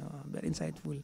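quiet repeat. Shown as a demonstration. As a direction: say very insightful again, dropping to a murmur. Repeated phrase, second take softer. (mahinang ulit)

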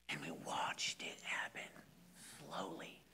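whispered, low sorrow; breathy hushed telling of a loss; hushed grief. Real, not acted. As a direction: whisper it as if it still hurts. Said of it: "hushed grief".

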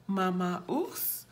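usual speaking voice

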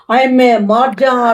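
low, slow "hai main mar ja"; mock despair. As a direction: drag it out in exaggerated grief. Aunt mock-despairs at lies. (ਹੌਲੀ, ਨੀਵੀਂ ਨਕਲੀ ਦੁਹਾਈ)

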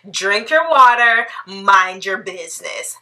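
ordinary talk

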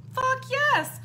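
high, loud exclamation; emphatic yes. Real, not acted. Emphatic answer to own question.

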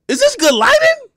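high, loud, squeaky mock of "Is this good lighting?"; mock fussy filmmaker. Shown as a demonstration. Mocking pros who worry about lighting, in a high squeaky voice. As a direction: ham up a fussy videographer. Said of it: "mocking pro camera".

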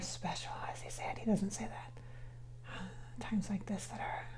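very soft, near-whispered aside; whispered self-correction. Real, not acted. Medium checking the spirit's words to herself, whispered aside. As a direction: whisper it while searching for the right words.

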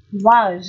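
"was" as full "wahz", open A; strong form, A vowel. Shown as a demonstration. Teacher rereading the three forms, strong open A in "was". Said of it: "Đọc mạnh này".

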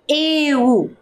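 "eu" said slowly and clearly; dictating "eu" for children to write; dictation. Shown as a demonstration. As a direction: dictate "eu" to the class. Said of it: "Olha o som".

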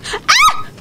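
very high, loud shriek of fright; terrified scream. Real, not acted. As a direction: scream as the monster lunges. Monster jumps out.

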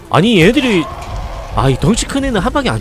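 sudden alarmed yelp, high; blurting out in a panic as enemies swarm; panicking gamer. Real, not acted. Swarmed by zombies in game. (당황한 게이머)

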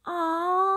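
loud high startled "ah"; sudden loud high yelp; startled cry. A narrator's reading. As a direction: yelp as the couch lifts. Couch lifts suddenly.

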